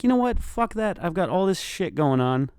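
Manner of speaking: high-pitched retold rant; indignant. Man talks himself out of letting the theft go, indignant. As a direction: get worked up, pitch jumping up.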